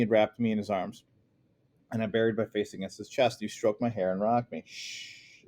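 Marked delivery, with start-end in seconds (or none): 4.7-5.4 whispered shh; soft shush. Acted. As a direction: hush her gently. Father hushes crying daughter.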